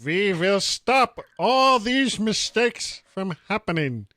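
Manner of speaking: slow, put-on German accent. Podcast host putting on a bad German accent for Tim Cook. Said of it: "I'm making Tim Cook a German".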